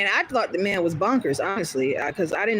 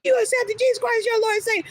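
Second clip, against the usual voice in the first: high-pitched mock yell of a zealot; imitated yelling; 14 semitones higher than usual. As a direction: yell it like someone accosting a stranger. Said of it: "how loud he was yelling at me".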